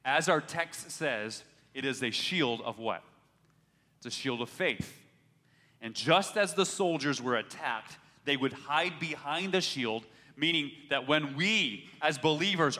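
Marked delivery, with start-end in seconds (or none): none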